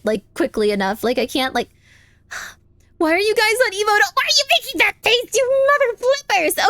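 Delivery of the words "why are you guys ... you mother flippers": high squeaky mock-angry complaint, slowing at the end; mock exasperation. Streamer scolding chat for emote faces, squeaky mock anger. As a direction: tell them off through a sigh, half-joking.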